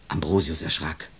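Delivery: plain reading voice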